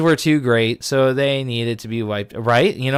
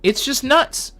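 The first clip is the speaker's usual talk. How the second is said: exasperated; 3 dB louder than usual. Real, not acted. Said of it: "exasperated at the story".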